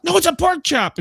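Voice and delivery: high-pitched mock indignation; insist, as if nobody believes you; retold exasperated protest. Retold protest, accused of saying pancake.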